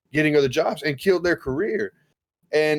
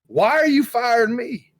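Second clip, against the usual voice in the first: mock indignant; 6 semitones higher than usual. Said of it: "I get mad".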